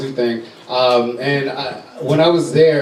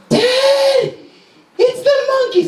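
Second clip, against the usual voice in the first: put-on much higher, louder squeal of a thrilled child; excited little girl; 4 dB louder, much slower than usual. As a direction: shout it like a five-year-old who just saw monkeys. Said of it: "she's like".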